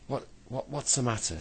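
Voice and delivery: plain reading voice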